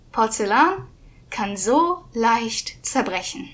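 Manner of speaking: slow echoing repeat. Acted. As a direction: draw it out like an echo.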